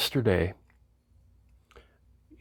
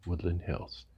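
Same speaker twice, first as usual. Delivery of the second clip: Woodland Hills said lower and much softer, deadpan; a resigned, quiet Woodland Hills; deadpan reveal; 5 semitones lower, 12 dB quieter than usual. Real, not acted. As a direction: drop Woodland Hills low and soft as the punchline. Deadpan reveal of his home.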